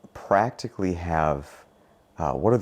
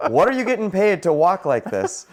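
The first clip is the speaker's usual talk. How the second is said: retold yell; 8 semitones higher, 3 dB louder than usual. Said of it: "I yelled at the family".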